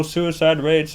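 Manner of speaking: ordinary delivery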